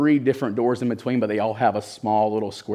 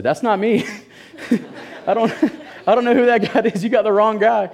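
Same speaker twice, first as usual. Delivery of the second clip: high, loud, laughing protest of innocence; panicked denial; 11 semitones higher, 5 dB louder than usual. Retold or imitated. To the officer arresting him, a high nervous laughing denial.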